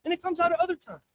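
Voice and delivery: normal talking voice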